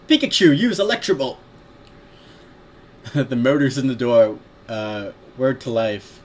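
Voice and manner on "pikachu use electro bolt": high, loud cartoon battle command; hammed-up anime-style shout; Pokémon trainer parody. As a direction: order the attack with mock heroics.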